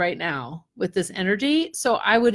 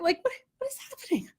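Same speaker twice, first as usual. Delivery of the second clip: very high, soft, fast whimper; mock bewildered squeal; 9 dB quieter, faster than usual. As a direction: squeak it out in comic helplessness. Playful bewilderment at the chaotic energy.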